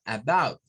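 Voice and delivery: about with a schwa start, uh-BOUT; English about. Shown as a demonstration. Teacher modelling about for the class. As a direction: say about the English way, weak first vowel. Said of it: "ya es el sonido schwa para esa palabra".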